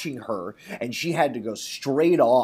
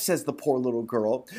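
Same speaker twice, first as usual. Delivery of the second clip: ordinary delivery, nothing marked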